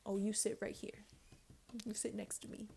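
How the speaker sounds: hushed imitating whisper of flirting; soft mock whisper to a crush. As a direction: whisper it like a sweet-talking guy. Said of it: "What did he say?".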